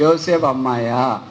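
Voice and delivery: mocking rhetorical question. Preacher mocking critics who say only girls cry, scoffing. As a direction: scoff at the objection, drawn out.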